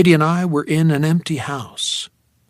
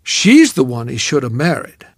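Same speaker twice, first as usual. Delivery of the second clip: ordinary delivery, nothing marked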